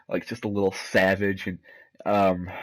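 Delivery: ordinary delivery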